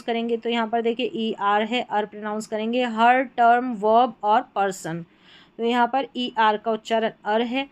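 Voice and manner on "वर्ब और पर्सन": ordinary talk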